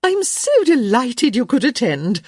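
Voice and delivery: high, loud gushing delight; effusive. A narrator's reading. Hostess thanking a guest for coming, effusive. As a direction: gush like a thrilled society hostess. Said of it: "Lady Plainsworth said effusively".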